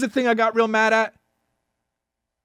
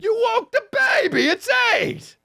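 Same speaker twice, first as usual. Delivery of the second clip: furious shout; 14 semitones higher than usual. Retold or imitated. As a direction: yell it at the stranger at the door. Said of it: "And I said".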